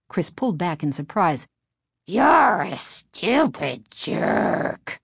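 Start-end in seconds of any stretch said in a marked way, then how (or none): 2.1-5.0 very slow, rasping puppet snarl; nasty harsh growl. A narrator's reading. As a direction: spit the insult like an evil dummy. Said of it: "repeated nastily in the same harsh growl".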